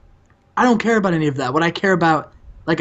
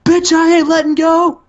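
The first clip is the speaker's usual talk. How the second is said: much higher, louder defiant yell; mock yell; 13 semitones higher, 6 dB louder than usual. Alex Wright refusing to release the leg lock, high mock yell. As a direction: scream it while holding the hold. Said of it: "Alex Wright's like".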